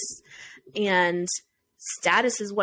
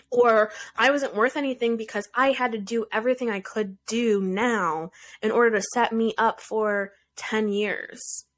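normal talking voice

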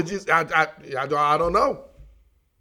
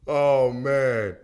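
ordinary delivery

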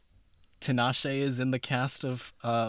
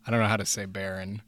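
normal talking voice

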